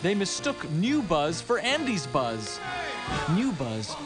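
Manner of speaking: usual speaking voice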